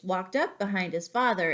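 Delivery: usual speaking voice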